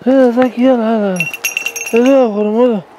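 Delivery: loud, urgent call to the dog. Real, not acted. Calling the dog back in.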